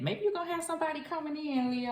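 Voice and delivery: suddenly thrilled, speeding up; a burst of real excitement; excited. Real, not acted. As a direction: pitch jumps high, words rush out fast. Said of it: "I don't know why I got excited about that".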